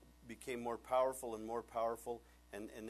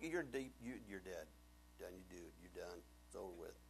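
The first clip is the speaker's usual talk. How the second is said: quiet verdict; 5 semitones lower, 7 dB quieter than usual. Acted. Daniel pronouncing king's doom.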